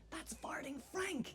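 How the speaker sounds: slow, hushed stage whisper; drawn-out whispered reveal; mock secret whisper. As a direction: whisper it so the next table won't hear. Secret remark to boyfriend nearby.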